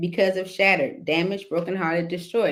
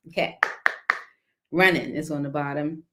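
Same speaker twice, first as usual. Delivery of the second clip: ordinary talk, nothing marked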